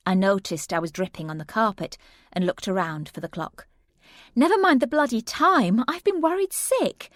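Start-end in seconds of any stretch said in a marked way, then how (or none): 4.4-7.1 much higher, louder telling-off; cross friend scolding. Acted. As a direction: scold her, worried sick turned to anger.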